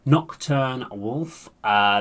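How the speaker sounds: normal talking voice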